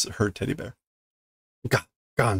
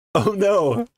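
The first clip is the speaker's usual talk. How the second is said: alarmed; 9 semitones higher, 3 dB louder than usual. Real, not acted. Reacting to teddy bear fight.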